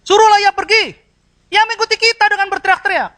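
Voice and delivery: loud, high-pitched angry shout; driving someone away. Shown as a demonstration. Disciples chasing the woman away, angry shouting. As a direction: shout it as an order to get rid of her. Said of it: "Kalimat yang satu mengusir".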